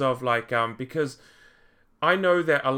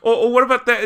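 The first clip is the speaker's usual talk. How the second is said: ordinary talk, nothing marked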